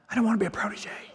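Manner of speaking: small whispered protest; meek whisper. Retold or imitated. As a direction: whisper it like a reluctant pupil. Meek reply to the mentor.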